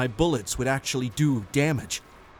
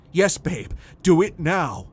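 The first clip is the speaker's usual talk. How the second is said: firm, raised command; insist, louder and higher; urgent insistence; 5 semitones higher, 5 dB louder than usual. A narrator's reading. Husband urging wife to act immediately, urgent.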